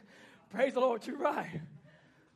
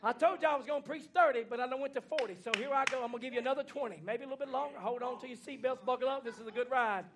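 normal talking voice

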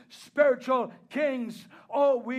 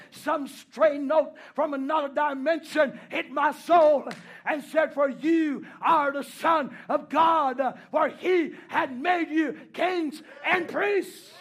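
preaching at full force; 4 semitones higher, 6 dB louder than usual. Acted. Preacher to the congregation, swelling into a shouted refrain. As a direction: build the refrain louder and higher, driving it home.

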